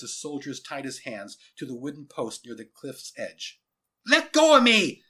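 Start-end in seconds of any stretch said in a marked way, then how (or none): 4.1-5.0 put-on high, loud struggling boy; furious high-pitched shout; yelling. A narrator's reading. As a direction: yell to be released. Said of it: "yelled Jason Rasmussen".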